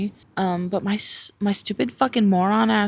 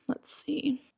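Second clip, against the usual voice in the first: whisper; 7 semitones higher, 8 dB quieter than usual. Real, not acted. Checking tracking, whispering to self.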